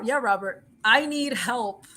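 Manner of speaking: ordinary talk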